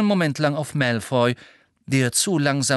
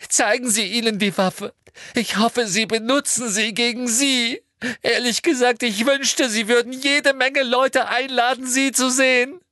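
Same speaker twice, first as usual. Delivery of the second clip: put-on very high, louder, spiteful girl; Hermine voice; 13 semitones higher, 4 dB louder than usual. A narrator's reading. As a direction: lash out in bitter spite. (Hermine hoch, laut und gehässig)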